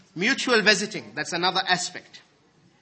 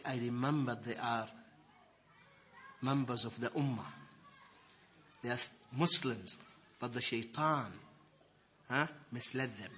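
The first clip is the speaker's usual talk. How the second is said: much softer and lower, mournful; sorrowful quote; 7 semitones lower, 11 dB quieter than usual. Acted. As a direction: speak his regret quietly, weighed down. Abu Darda's sorrowful words quoted.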